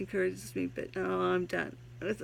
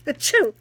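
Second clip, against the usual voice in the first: fake sneeze; 6 semitones higher, 13 dB louder than usual. Sneezes mid-intro, jokes allergic.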